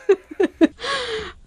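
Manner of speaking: high burst of real laughter; laughing. Real, not acted. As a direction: crack up after confessing the whisper. Cracks up at own whispered line.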